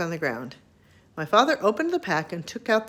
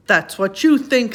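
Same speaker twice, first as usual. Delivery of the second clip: lion threatening; 4 semitones higher, 7 dB louder than usual. A narrator's reading.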